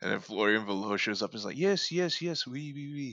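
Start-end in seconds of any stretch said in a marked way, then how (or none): 1.6-3.1 put-on higher, comic French accent. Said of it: "His French accent is very good".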